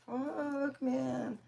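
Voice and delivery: high, drawn-out mock groan; retold dismay. Overhearing the uncle's call, high mock dismay.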